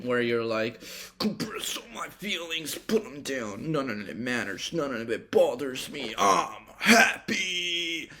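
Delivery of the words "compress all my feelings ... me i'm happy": strained, hammed-up self-convincing; forced happiness. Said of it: "it's not a forcible decision".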